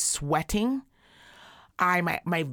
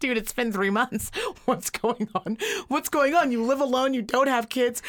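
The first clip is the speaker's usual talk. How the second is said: higher, incredulous retold questioning; mock exasperation; 5 semitones higher than usual. Friend questioning her three months of sickness, mildly exasperated. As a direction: demand an explanation, half playing it up.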